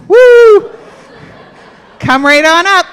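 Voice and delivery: loud, high whoop of delight; excited whoop. Real, not acted. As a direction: cheer them up the aisle. Cheering bones coming up aisle.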